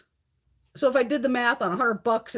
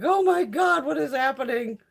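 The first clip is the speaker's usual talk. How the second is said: retold panic; 6 semitones higher than usual. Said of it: "I'm like".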